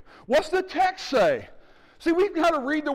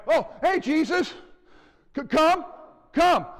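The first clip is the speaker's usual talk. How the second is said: Peter calling out to Jesus; 6 semitones higher than usual. Acted. Peter in the boat calls Jesus to him, pitched up and eager. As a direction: beckon him over the waves, keen and raised.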